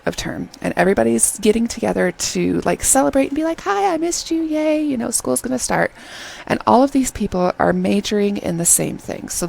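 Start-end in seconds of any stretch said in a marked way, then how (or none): 3.6-5.9 high-pitched, hurried imitation of excited friends; mock-excited reunion chatter, pitched way up; gushing greeting. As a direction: imitate friends squealing hello.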